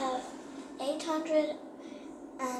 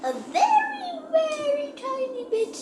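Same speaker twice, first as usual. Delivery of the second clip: much higher, small squeak for tiny; tiny squeaky voice; 13 semitones higher, 3 dB louder than usual. Real, not acted. Describing a tiny amount.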